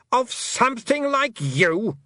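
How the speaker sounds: shrill high scorn, loud on Stole; incredulous queen. A narrator's reading. A fairy queen scoffing at the girl, loud and high. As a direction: scoff at the very idea.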